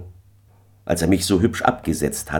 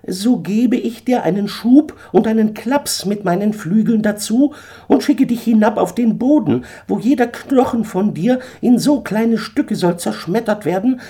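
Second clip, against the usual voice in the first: much higher, threatening bird voice; menacing eagle; 3 dB louder than usual. Acted. Eagle threatens to shove Daniel off, high and menacing. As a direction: threaten him sweetly from above.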